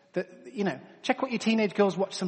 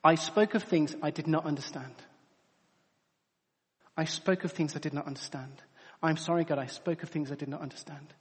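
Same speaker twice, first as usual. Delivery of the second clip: humble repentance; 6 semitones lower, 8 dB quieter than usual. Retold or imitated. Job humbly repents before God.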